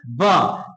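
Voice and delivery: ब as an isolated "buh", dropping low; phonics letter sound. Shown as a demonstration. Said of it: "क्या होता है?".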